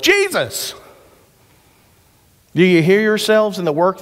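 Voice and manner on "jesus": high, eager shout of the answer; kids yelling. Retold or imitated. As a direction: call it out like a room of excited kids. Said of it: "Every one of the kids yelled".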